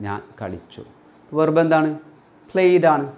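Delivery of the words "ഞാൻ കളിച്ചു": ഞാൻ കളിച്ചു said much softer, falling to a close; teacher's quiet repeat of ഞാൻ കളിച്ചു. Shown as a demonstration. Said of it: "അപ്പോൾ played".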